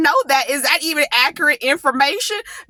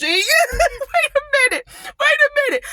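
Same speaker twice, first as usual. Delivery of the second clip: laughing; 12 semitones higher than usual. Real, not acted. Laughing interruption.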